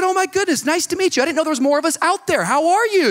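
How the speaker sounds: high, loud, rushed greeting; overjoyed to find a fellow believer; hammed-up excited hello; gushing delight.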